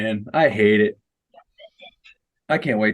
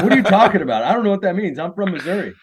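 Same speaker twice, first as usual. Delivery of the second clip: baffled; 9 semitones higher, faster than usual. Retold or imitated. His own baffled reply in story.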